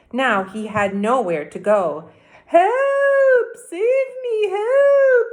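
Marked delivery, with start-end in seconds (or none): 2.5-5.3 high, loud cries for help; cried for help. A narrator's reading. Anthony stuck up a tree, loud high cry for help. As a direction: yell it from the top of the tree. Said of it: "he cried".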